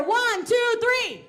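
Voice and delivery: hype countdown. Real, not acted. Counting crowd in.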